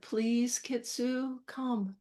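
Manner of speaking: soft, spaced-out quiet plea; gentle plea. Retold or imitated. Spent owner asking the dog to come, gentle plea. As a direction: say it quietly, a pause after each word. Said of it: "after I caught my breath a little bit, I just said".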